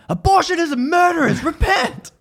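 high, loud protester's cry; imitated yelling. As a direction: yell it over the fence like a zealot. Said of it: "drowned out by someone yelling".

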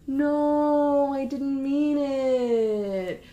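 high, long-drawn whiny plea; hammed-up wailing, stretching every word right out; mock wail. Boyfriend begs after death threat.

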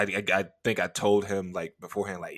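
ordinary talk

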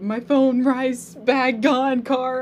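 mock tearful. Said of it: "tears in your eyes".

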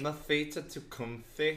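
slow, teasing Manchester imitation; amused mock Manchester accent; mimicked Manchester accent. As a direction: echo a bad Manchester accent, drawn out.

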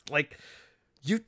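ordinary talk, nothing marked

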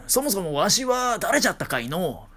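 a forgetful grandpa asking; old man voice. Pretend. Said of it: "みたいなね". (おじいさん口調)

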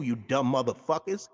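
ordinary talk

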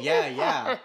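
a high, loud "Yeah, yeah" with a laugh; mocking outsiders. Friend mimics how outsiders say yeah, high and laughing. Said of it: "they're saying".

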